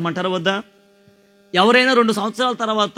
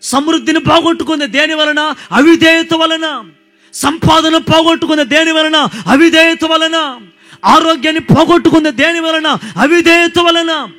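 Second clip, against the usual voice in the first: full-force preaching; 9 semitones higher, 8 dB louder than usual. Acted. Preacher hammering the congregation about disobedience, loud and high. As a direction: drive the point home like a revival preacher.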